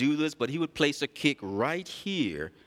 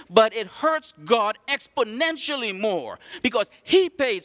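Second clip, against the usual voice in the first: raised, ringing pulpit voice; full-force preaching; 7 semitones higher, 4 dB louder than usual. Acted. Pastor preaching to the church, raised and ringing. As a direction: preach it out to the congregation.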